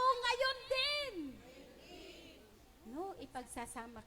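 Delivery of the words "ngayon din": high and loud, ngayon punched; congregation echo. Shown as a demonstration. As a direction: call out "Ngayon din" high and loud with the stress on ngayon. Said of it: "Pakisabi nga po".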